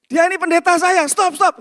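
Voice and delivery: high, loud shout to halt a fight; urgent shouted intervention. Retold or imitated. Young man running to defend his pastor, urgent shouting. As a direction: yell it out in short, high bursts. Same rescuer's shout, translated.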